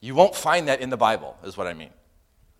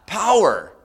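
higher, louder, landing the joke; shouted punchline; 10 semitones higher, 9 dB louder than usual. Acted. Preacher shouting the punchline to the congregation. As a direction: belt the answer out.

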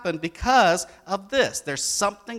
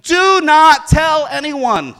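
slow, much louder and higher, each word hammered; stern, emphatic command; 11 semitones higher, 16 dB louder, much slower than usual. Acted. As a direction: lean hard on every word like a stern order. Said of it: "Jesus being really stern ... really emphatic".

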